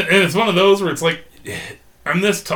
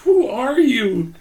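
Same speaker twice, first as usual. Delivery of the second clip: high-pitched, plaintive mock bewilderment; pure confusion; 10 semitones higher, 5 dB louder than usual. Friend acting out the slapped man's thoughts, comic confusion. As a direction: act out baffled pleading, half laughing. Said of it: "that moment of just pure confusion".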